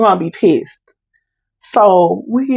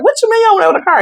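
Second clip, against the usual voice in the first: put-on much higher, exasperated teen snap; irritated teen; 13 semitones higher, much faster than usual. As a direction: nag your mom like an annoyed teenager. Teenager snapping at mother.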